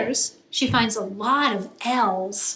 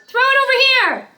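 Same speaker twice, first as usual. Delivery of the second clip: high, loud shout across the yard; yelling; 13 semitones higher, 7 dB louder, much faster than usual. Acted. Brother shouting for his ball back, loud high yell. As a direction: call for the ball from far away. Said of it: "her brother yells".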